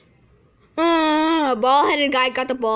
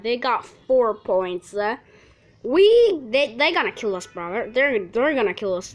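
ordinary talk, nothing marked

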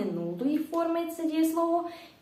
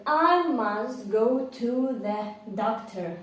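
slow model sentence; slower than usual. Shown as a demonstration. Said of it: "Наприклад".

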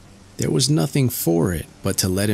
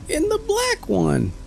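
tiny Tom Thumb. A narrator's reading. Tom answers master.

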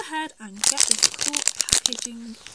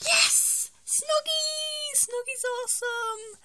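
very high, squealed celebration; overjoyed; 12 semitones higher, much slower than usual. Real, not acted. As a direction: squeal with delight at getting the one wanted. Delighted reveal of second figure.

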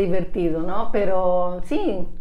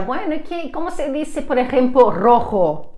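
higher-pitched mock-innocent question; teasing; 6 semitones higher than usual. Classmates mocking her R, high teasing question. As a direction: imitate teasers setting a trap. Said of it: "la gente se burlaba y decía".

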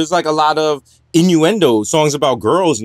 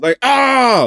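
anguished wail; 6 semitones higher than usual. Pretend. Mock anguished cry.

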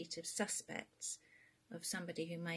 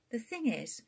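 Queenie; 5 semitones higher, 5 dB louder than usual. Acted.

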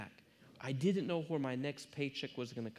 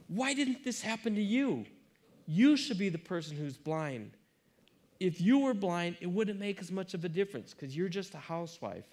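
a loud, bitter outburst that goes higher; angry outburst; 7 semitones higher, 7 dB louder than usual. Retold or imitated. Husband yelling cruelly at his wife on the ladder, loud and furious. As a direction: lash out at her in fury.

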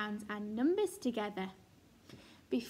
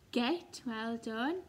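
ordinary talk

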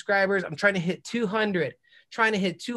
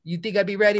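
ordinary talk, nothing marked

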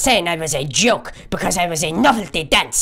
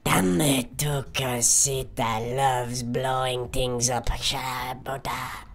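villain voice; 6 semitones lower, 7 dB quieter, much slower than usual. Acted. Villain boasting about explosions.

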